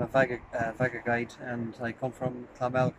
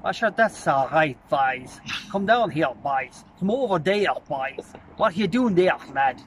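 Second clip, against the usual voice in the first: louder, lilting northern Tipperary accent; 3 semitones higher, 5 dB louder than usual. Shown as a demonstration. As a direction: call to the boys in a rural northern Tipperary lilt. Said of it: "when you go up to, uh, northern part".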